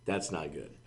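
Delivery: ordinary talk, nothing marked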